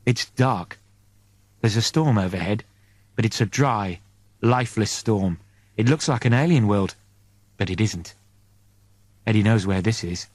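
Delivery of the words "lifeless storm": ordinary delivery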